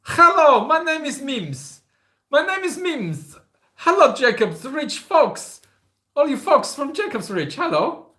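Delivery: very high, slow, loud character voice; imagined Mims voice. Pretend. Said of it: "I don't know if that's how you speak".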